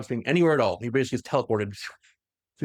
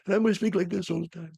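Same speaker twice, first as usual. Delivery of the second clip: put-on higher, hushed old man's voice; whispery old man; 5 semitones higher than usual. Imitating Malcador, who whispers from old age, whispery old voice. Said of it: "he's just kind of whispering because he's very old".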